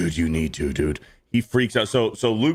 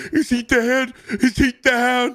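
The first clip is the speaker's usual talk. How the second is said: mocking a panicked hunter in a much higher, strained voice; panicky high voice; 4 dB louder, slower than usual. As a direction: squeak it out like a frantic, scared celebrity hunter. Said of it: "He's like".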